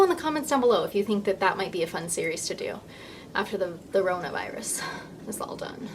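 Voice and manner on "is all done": soft unvoiced whisper. Real, not acted. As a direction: drop to a whisper for the aside. Whispered trailing aside.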